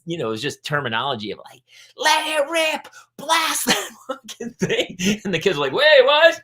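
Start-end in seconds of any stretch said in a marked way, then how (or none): 2.0-5.0 high, drawn-out yell; sideline coach shouting. Retold or imitated. Soccer coach egging kids on to shoot, shouting. 5.7-6.4 high, loud startled question; baffled kids. Retold or imitated. Young players answering their yelling coach, baffled.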